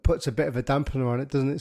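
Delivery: ordinary talk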